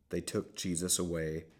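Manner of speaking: ordinary delivery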